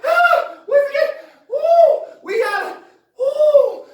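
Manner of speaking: high, loud, hammed-up panic yelps; breathless high-pitched whooping; panicked hollering. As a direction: yelp like a man who just fled a monster. Panicked dad to family.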